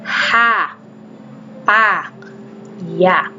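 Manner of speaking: slow, clear syllables one by one; teacher drilling syllables. Shown as a demonstration. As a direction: read each syllable aloud for the class. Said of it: "Okay, ito".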